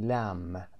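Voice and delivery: "لم" ending on a plain M, no B; silent B. Shown as a demonstration. Teacher demonstrating pronunciation to students, silent B in "لم". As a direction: say "لم" with the final B left silent.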